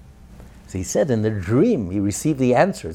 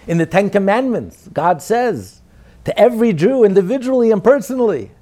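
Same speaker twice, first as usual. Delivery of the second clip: loud, higher, driving sermon cadence; preaching at full force; 3 semitones higher, 6 dB louder than usual. Acted. Rabbi proclaiming God's names to the congregation, fervent loud preaching. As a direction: build the fervor name after name.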